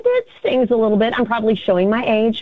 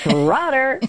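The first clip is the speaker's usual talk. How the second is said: her own phone greeting; 5 semitones higher than usual. Shown as a demonstration. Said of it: "you did have a way of answering the phone".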